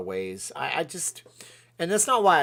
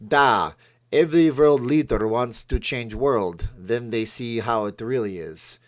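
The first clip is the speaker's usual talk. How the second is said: loud, hammed-up Russian accent; bad Russian accent; 8 dB louder than usual. Quoting Vladimir Putin's honest remark, bad Russian accent. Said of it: "That's my bad Russian accent".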